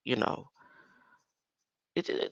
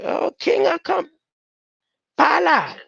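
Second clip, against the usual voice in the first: calling out; 13 semitones higher, 8 dB louder than usual. Real, not acted. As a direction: belt it out like a mic check. Calls out loudly, mic check.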